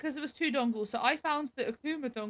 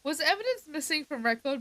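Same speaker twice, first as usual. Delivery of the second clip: excited; 3 semitones higher than usual. Real, not acted. Excited checking bingo card.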